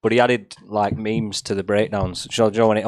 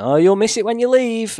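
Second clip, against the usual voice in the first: mock nagging adult; 11 semitones higher, 3 dB louder than usual. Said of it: "mum and adults would be like".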